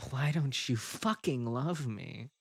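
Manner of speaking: ordinary talk, nothing marked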